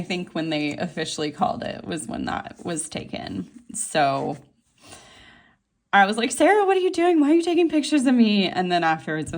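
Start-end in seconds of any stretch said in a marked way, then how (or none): 6.3-8.5 high, loud, rushed retold objection; mock protest. Speaker to friend taking pictures, high mock protest. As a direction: re-enact flustered protesting, pitched way up. Retelling protest at being photographed.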